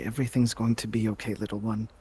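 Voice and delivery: soft, low, comforting murmur; tender reassurance. Acted. Doc hugging Una goodbye, tenderly reassuring.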